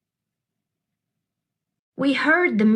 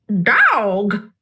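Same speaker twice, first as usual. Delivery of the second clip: louder parrot squawk; 4 dB louder than usual. Acted. Parrot Gertrude squawking at the dog. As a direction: squawk it like a bird. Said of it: "Gertrude squawked".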